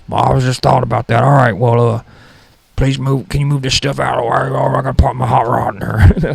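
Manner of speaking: put-on a fussy old guy barging into the garage; crazy old guy. Old roommate interrupting to park his car, fussy put-on voice. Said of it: "That's the worst character of all time".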